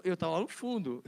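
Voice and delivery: usual speaking voice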